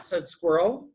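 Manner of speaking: plain reading voice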